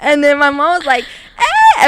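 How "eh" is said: high, loud startled shriek; imitated scream. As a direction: yelp like everyone in a panic. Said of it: "Everyone's screaming".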